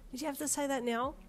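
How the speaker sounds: high-pitched exasperated question; peeved complaint; snapped. A narrator's reading. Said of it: "you snapped".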